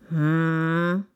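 drawn-out humming growl; bear grumble. A narrator's reading. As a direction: grumble while considering. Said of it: "brummte der Bär".

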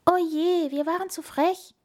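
high-pitched hedgehog lament; plaintive storybook wail. A narrator's reading. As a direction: whine with worry. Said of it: "jammerte der Igel".

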